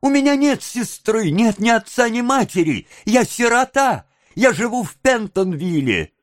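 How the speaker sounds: put-on high, pleading orphan boy; terrible alarm. A narrator's reading. Oliver denying family to onlookers, alarmed protest. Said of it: "воскликнул Оливер в страшной тревоге".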